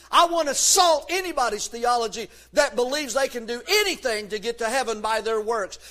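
usual speaking voice

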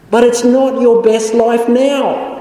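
emphatic rebuttal; pointed rebuke. Acted. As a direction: call out the preacher's slogan. Rebuke to Joel Osteen.

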